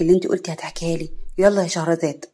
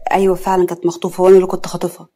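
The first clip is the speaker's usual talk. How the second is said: ordinary delivery, nothing marked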